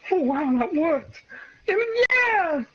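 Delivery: high, loud mock triumph; gloating hero. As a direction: cheer your own win in a high put-on voice. Hero gloats after the throw.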